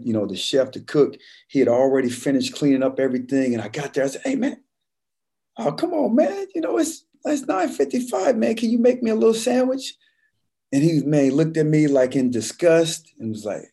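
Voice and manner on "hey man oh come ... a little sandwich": high, pleading plea for a sandwich; high whiny begging. Retold or imitated. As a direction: beg the cook sweetly in a high voice. Pleading with cook at closing.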